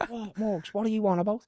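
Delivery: high, laughing "What, Morgs"; voicing the coach laughing at a silly question; laughing mock disbelief. Coach laughing at young player's question, high amused voice. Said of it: "he's fucking burst out laughing".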